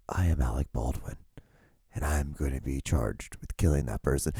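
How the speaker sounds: lightly voiced, soft put-on confession; a hint of a hushed celebrity voice; mock Alec Baldwin. Vlogger imitating the video's speaker, soft put-on voice.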